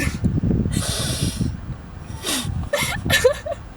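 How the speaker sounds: long, high wheezing laugh; helpless laughter. Real, not acted. Friend losing it over the story, wheezing laugh. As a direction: laugh until almost no voice is left.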